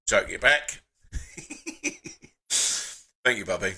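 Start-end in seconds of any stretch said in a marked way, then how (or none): 1.1-2.1 high breathy soft laugh; quiet choked-up giggle; soft teary laugh. Real, not acted. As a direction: laugh away the tears quietly. Teary laugh after wife's message.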